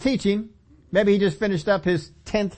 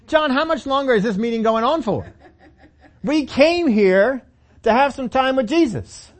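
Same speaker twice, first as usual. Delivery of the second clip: raised, impatient disciples' grumble; whiny complaint; 5 semitones higher than usual. Retold or imitated. Preacher voicing disciples complaining about the long meeting. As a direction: grumble like a tired disciple who wants Jesus to himself.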